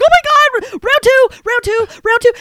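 very high squealed chant; shaking with excitement. Retold or imitated. Fan too shaky to hold the phone, squealing. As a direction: relive it giddy and overwhelmed, pitch way up. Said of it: "shaking 'cause I was like".

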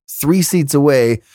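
ordinary delivery

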